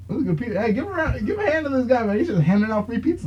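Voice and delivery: high mock-excited hype voice; imitating Jorge Masvidal. Fighter praises the pizza guy to the room, hammed-up high. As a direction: cheer the pizza guy like a showman. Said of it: "He's like".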